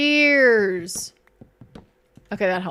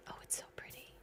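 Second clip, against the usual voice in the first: whisper; 29 dB quieter, much faster than usual. Real, not acted. Admiring a flower.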